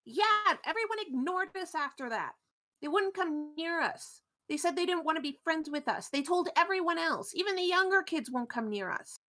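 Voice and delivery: put-on high-pitched, aggrieved kid complaining; high, plaintive character voice; upset Ash. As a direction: pour out the hurt in a high voice. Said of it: "Ash just nods and says".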